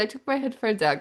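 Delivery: ordinary talk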